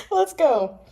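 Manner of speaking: giggly, flustered laughter; blushing and giggling; laughing. Real, not acted.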